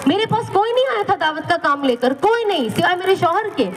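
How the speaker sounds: ordinary delivery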